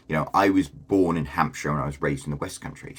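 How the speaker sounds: ordinary delivery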